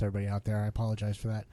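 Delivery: ordinary talk, nothing marked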